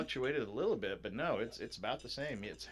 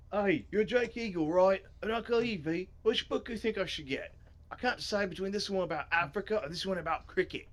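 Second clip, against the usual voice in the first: higher, hearty Australian character voice; Australian accent; 9 semitones higher, 5 dB louder than usual. Acted. Reader as Griff asking which book to get, Australian accent. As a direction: play him as a broad Aussie. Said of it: "why did I make him Australian?".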